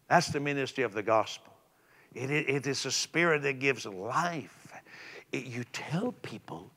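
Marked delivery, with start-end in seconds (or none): 5.3-6.7 whisper. Real, not acted. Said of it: "sudden whisper in sermon".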